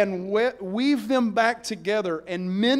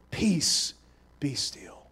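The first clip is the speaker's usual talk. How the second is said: calm command; 7 semitones lower, 4 dB quieter, slower than usual. Retold or imitated. Jesus calms the storm.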